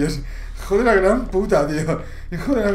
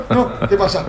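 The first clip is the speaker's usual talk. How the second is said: ordinary delivery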